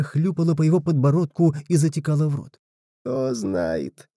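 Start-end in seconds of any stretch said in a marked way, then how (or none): 3.0-4.1 low, soft, drawn-out; drawling. A narrator's reading. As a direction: stretch it out lazily. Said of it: "протянул тот".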